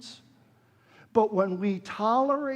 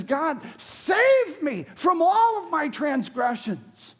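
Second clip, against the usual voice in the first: emphatic command; 5 semitones higher, 5 dB louder than usual. Retold or imitated. Preacher voicing David's emphatic cry to God. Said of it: "in the most emphatic, imperative tense commands".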